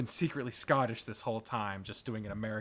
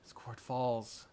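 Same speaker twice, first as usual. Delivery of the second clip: plain reading voice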